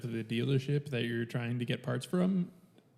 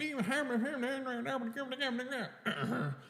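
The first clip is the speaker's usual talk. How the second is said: garbled walkie-talkie chatter; 12 semitones higher than usual. Retold or imitated. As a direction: imitate unintelligible chatter over a walkie-talkie. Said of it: "every fucking word that came over it".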